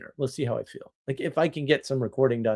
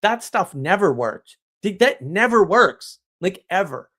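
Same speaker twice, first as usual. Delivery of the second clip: retold amazement; 5 semitones higher, 5 dB louder than usual. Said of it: "And I was like".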